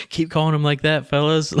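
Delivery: high, teasing mock praise; sarcastic joke. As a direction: rib the crew with fake praise. Joking quote after shot ducks.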